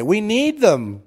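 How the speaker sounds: hammed-up begging vampires; mock vampire townsfolk. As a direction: whine it like needy vampires begging to feed. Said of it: "they'll say".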